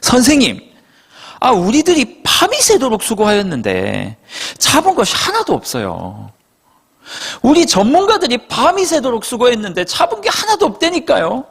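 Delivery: acted-out exasperated complaint; tinged with irritation. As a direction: whine in protest, pitch climbing high. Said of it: "짜증이 좀 섞였어요".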